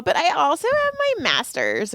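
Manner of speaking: put-on high-pitched affected girly brag; hot girl voice. Hot girl tells Joy of her degree, affected voice. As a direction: mimic an airhead showing off. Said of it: "why are you talking like that".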